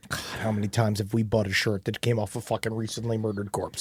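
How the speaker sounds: hushed horrified whisper. Real, not acted. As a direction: whisper it in disbelief.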